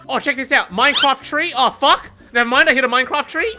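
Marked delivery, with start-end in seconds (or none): none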